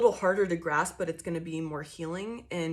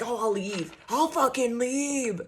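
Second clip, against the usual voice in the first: mock threat to leave; 4 semitones higher, slower than usual. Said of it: "they come at you like".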